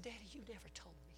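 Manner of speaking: breathy whisper, barely voiced; hushed whisper. Son whispering to his weeping father, barely voiced. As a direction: whisper it in disbelief.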